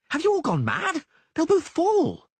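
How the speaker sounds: very high, loud indignant cry; outraged shout. Acted. Dandelion berates the Reavers, high outraged shout. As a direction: rage at their cruelty.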